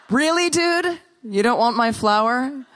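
high-pitched, affronted questioning; indignant disbelief. Acted. Living statue to the dude who refused the flower, indignant disbelief.

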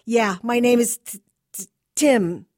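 loud, high stammer on the name; nervous stutter. Acted. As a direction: trip over the T nervously. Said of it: "stuttered the nervous kid".

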